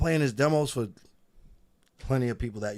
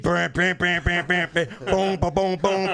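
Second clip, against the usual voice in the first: imitating beat; 7 semitones higher, 4 dB louder than usual.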